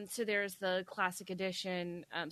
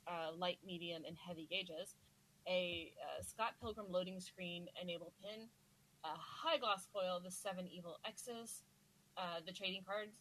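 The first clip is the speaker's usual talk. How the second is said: fading off-mic, trailing into distance; 10 dB quieter than usual. Real, not acted. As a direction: voice drifting away from the mic. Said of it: "[name], your voice is fading".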